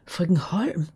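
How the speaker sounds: breathless whispered disbelief; a stunned, voiceless whisper; shocked whisper. A narrator's reading. Shocked girl hears father remarried.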